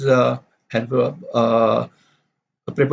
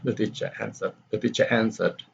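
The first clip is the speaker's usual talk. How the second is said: "the teacher answered" dropped away, much softer; unstressed tag; 3 semitones lower, 8 dB quieter, faster than usual. Shown as a demonstration. Teacher reading the reporting clause twice, unstressed. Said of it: "The teacher answered is not stress".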